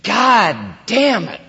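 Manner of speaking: high, loud, drawn-out oath; acted forceful swearing; powerful mock curse. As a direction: hammer each word out slowly with force. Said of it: "express yourself with power".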